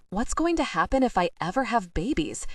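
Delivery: usual speaking voice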